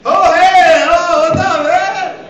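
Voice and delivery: high, loud awkward hey; high, loud startled hello to coworker at urinal; startled greeting. Retold or imitated. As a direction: yelp it out, caught off guard.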